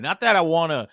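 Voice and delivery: usual speaking voice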